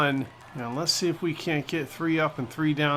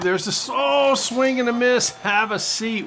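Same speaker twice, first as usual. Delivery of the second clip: excited call; 7 semitones higher, 5 dB louder than usual. Real, not acted. Strikeout call.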